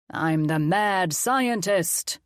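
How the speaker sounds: slow, drawn-out hammed-up villain voice; mad scientist. Colin playing mad scientist to the kids, gloating.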